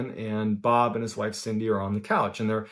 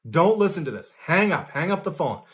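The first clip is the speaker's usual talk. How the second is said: urgent, higher-pitched mock yell; retold yelling; 5 semitones higher than usual. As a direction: imitate yelling a warning down the phone, without full volume. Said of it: "he starts yelling to Michael".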